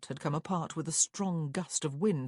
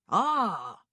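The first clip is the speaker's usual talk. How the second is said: high, loud "Ah" of discovery; triumphant clerk's exclamation; 6 semitones higher, 7 dB louder than usual. A narrator's reading. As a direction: crow it like you've just worked it out. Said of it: "the clerk said triumphantly".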